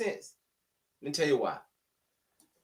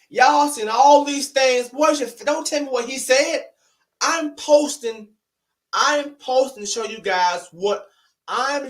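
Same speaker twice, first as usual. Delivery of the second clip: heated raised rant; 5 semitones higher, 4 dB louder than usual. Real, not acted. Vlogger lectures commenters about facts versus hearsay, loud and heated. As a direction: hammer the point home at your critics.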